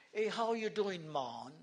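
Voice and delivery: lilting West Indian greeting, falling on "man"; light imitation of West Indian lingo; West Indian accent. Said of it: "this is how they speak".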